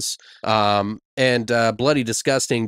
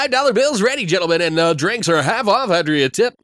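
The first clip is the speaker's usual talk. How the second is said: strip club DJ; 8 semitones higher, 3 dB louder than usual. Pretend. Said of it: "I totally sound like it".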